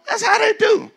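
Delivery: a high squeaky mock aside. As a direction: toss it off in the gossip voice. Mocking voice.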